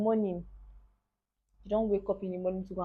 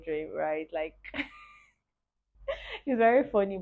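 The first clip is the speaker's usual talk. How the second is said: laughing; 4 semitones higher, 4 dB louder than usual. Real, not acted. Laughing about the dream.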